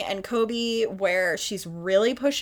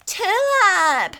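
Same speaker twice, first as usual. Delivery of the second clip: high, raised calls, each one falling; calling out; 11 semitones higher, 5 dB louder, much slower than usual. Retold or imitated. Girl calling for her lost cow in the storm, high and raised. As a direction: call across the field for the lost cow.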